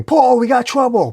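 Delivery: high, loud cry for help; urgent high-pitched yell; panicked scream. A narrator's reading. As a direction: scream out a warning. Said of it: "Dylan screaming".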